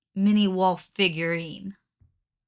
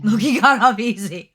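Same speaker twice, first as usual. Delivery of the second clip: laughing; 9 dB louder than usual. Real, not acted.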